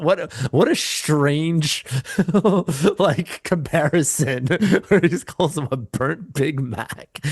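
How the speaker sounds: drawn-out words broken up by laughter; laughing through words. Real, not acted. Podcaster tickled by a burnt Big Mac joke, laughing as he talks. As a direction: talk slowly while cracking up. Cracking up at the film's line.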